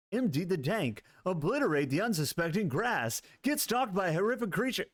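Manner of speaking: radio DJ reading a game blurb; announcer voice. Pretend. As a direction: sell the description like a DJ hyping a track, pitch rising at the end. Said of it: "you guys like my announcer voice".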